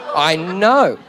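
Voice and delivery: ordinary talk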